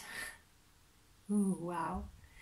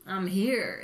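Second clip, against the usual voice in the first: a guarded, stern hum, then a short flat reply; 7 dB louder, much slower than usual. Retold or imitated. Mimicking the guarded other person, stern and closed off. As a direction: hold back like someone in armor. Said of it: "really stern kind of an energy".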